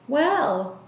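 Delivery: timid, hesitant start; voice shaking. Acted. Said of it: "her voice shook".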